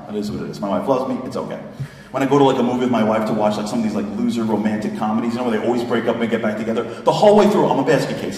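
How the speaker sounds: ordinary delivery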